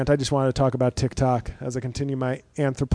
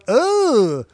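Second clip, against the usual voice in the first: disgusted groan; 9 semitones higher, 6 dB louder than usual. Retold or imitated. Disgusted reaction to reveal.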